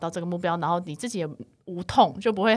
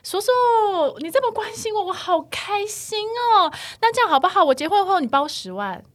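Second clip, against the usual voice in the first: sweeten it up to corner him; sweet sarcasm; 11 semitones higher, 5 dB louder than usual. Retold or imitated. Niece sarcastically answering a nosy uncle, high and sugary. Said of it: "我就跟那个叔叔说".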